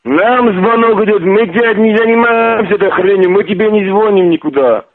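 high-pitched, heated protest; worked-up, exasperated denial; exasperated insistence. Real, not acted. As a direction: protest your innocence with pitch pushed up. Heated denial in phone quarrel.

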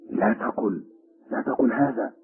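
usual speaking voice

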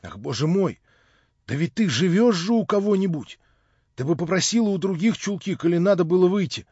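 high, loud remonstrance; exasperated concern. A narrator's reading. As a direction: scold out of worry.

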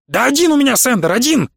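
high exasperated shout; shouted back. A narrator's reading. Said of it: "выкрикнул в ответ профессор".

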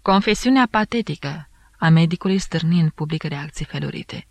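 plain reading voice

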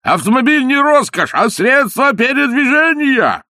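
high, loud, slowed last slogan; shouted slogan. A narrator's reading. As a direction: holler it after the departing car. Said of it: "выложил последний лозунг".

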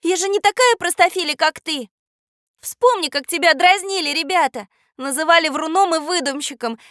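high-pitched indignant rebuke. Acted. Katya reminds the boy he was teased, indignant. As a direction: scold him back in a high girl's voice. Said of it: "возмутилась Катя".

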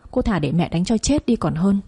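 plain reading voice

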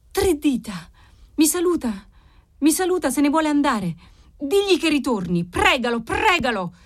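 high-pitched, anxious exchange; agitated, pleading urgency; urgent agitation. Acted. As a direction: keep the pitch up, pressing and alarmed. Vision departs, urgent exchange with father.

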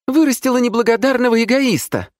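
high, loud indignant squeal; визгливый выкрик. A narrator's reading. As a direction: squeal it out as an outraged mother. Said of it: "взвизгнула она". (возмущённо взвизгнуть)

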